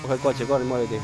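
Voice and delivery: loud, urgent command; urgent shout. Real, not acted. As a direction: yell at them to move. Urging teammate to drive off.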